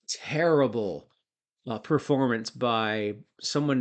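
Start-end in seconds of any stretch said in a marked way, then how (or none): 0.1-1.1 slow, drawn-out "terrible," jowly and heavy. Retold or imitated. Second "terrible" dragged out slowly.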